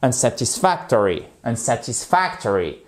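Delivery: "Unsatisfactory" with the beat landing on FAC; rhythmic stress. Shown as a demonstration. Teacher repeats the rhythm for learners, measured stress. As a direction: keep the rhythm through "Unsatisfactory". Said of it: "il y a un rythme".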